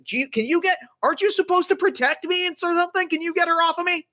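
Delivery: hurried, high-voiced appeal to a protector; high-pitched plea. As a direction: plead fast in a high voice. Acted plea to be rescued.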